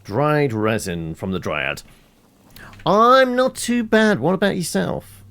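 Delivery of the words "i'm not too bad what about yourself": chirpy greeting. Real, not acted. Streamer answering viewer Gino, high sing-song. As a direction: chirp a friendly reply to a fan.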